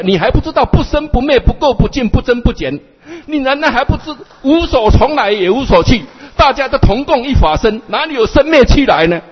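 exasperated teacher's loud scolding; scolding master. Acted. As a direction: berate a hopeless disciple. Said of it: "这样呵斥我了".

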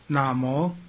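南无 said as two drawn-out syllables, na-mo; teacher modelling 南无 with a long final o; model pronunciation. Shown as a demonstration. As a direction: say 南无 slowly for students to repeat. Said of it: "跟着我念就好哈".

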